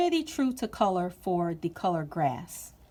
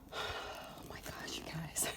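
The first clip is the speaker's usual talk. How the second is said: overwhelmed whisper; 4 semitones lower, 15 dB quieter than usual. Real, not acted. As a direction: whisper it, overcome with joy.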